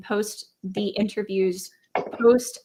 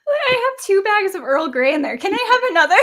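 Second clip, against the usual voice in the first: high pleading beggar voice, laughing; Oliver Twist; 9 semitones higher, 5 dB louder than usual. Acted. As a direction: beg for more with a laugh. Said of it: "Oliver Twist".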